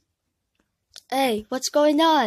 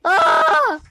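rising high screams of fright; mock scream; 7 semitones higher, 5 dB louder, slower than usual. Real, not acted. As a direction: shriek at the witch. Brother called the witch.

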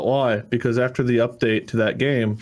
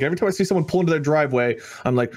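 usual speaking voice

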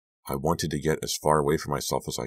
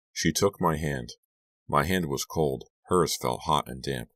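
usual speaking voice

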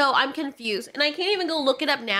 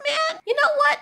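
squeaky outraged jab; 13 semitones higher than usual. Retold or imitated. Orc rant peaks.

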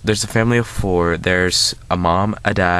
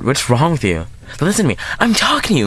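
mock exasperated demand; 8 semitones higher, faster than usual. Sister to brother lying face down, acted exasperation. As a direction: pitch climbing to a high, insistent scold.

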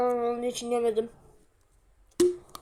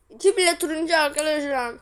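louder, higher, mouth burning; pained loud reaction; 4 semitones higher, 6 dB louder than usual. Real, not acted. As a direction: push through the heat. Eating hot pepper, reacting.

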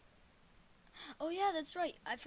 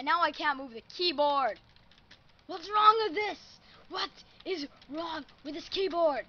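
loud, higher, exasperated shout at the keyboard; real angry outburst at a dead keyboard; frustrated yell; 5 semitones higher, 11 dB louder than usual. Real, not acted. Keyboard stops working mid-game.

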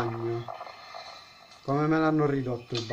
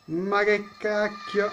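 slow, drawn-out, higher startled exclamation; 6 semitones higher, slower than usual. Real, not acted. Player spotting something creepy, slow startled disbelief. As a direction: caught off guard, stretch it out in disbelief.

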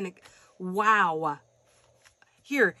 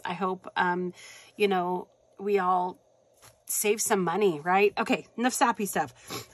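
ordinary delivery

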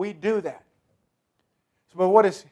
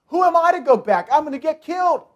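frightened protest; 7 semitones higher, 5 dB louder than usual. Acted. Moses protests to God, frightened.

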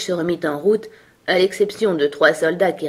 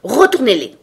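loud, higher angry command; raised, irate royal voice; wrathful order; 4 semitones higher, 5 dB louder than usual. A narrator's reading. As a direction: order the servant crossly. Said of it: "d'un air courroucé et ordonna".

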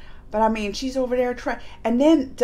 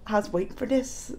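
quiet smug quote; 3 semitones lower, 9 dB quieter than usual. Retold or imitated. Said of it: "He's like".

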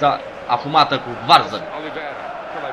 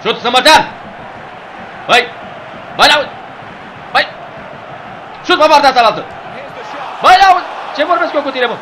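screaming at players; 11 semitones higher than usual. Real, not acted. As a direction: yell orders at players who won't listen. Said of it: "țip după ei".

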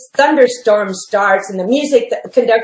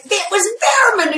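exasperated; 10 semitones higher than usual. Retold or imitated. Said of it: "I'm like".

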